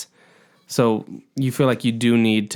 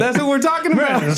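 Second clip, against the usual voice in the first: laughing; 14 semitones higher than usual. Real, not acted. Teasing friend about trailers.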